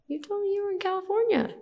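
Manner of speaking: a hint of an accusing little girl; daughter.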